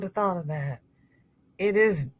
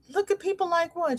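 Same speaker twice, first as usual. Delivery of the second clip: high, softer innocent question; feigned innocence; 12 semitones higher, 5 dB quieter than usual. Retold or imitated. Mother answering her scolding daughter, high-pitched innocence. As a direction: ask it high, as if clueless. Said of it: "And I'm like".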